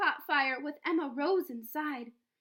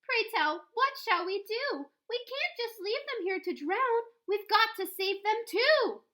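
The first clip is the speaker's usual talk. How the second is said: raised, pleading sea captain's voice; a captain begging to save the animals, voiced higher; worried captain; 7 semitones higher than usual. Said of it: "The captain said to Mister Payne".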